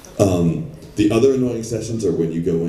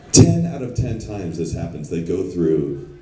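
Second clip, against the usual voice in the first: usual speaking voice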